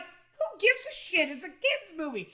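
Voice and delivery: exaggerated high-pitched dismissal; mock exasperation. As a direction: act out a baffled retort. Retelling own exasperated reply.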